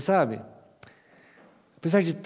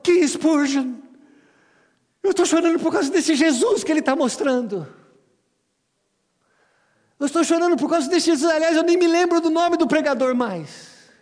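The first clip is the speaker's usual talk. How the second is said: mock tearful; 13 semitones higher, 9 dB louder than usual. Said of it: "o amigo chorando virou".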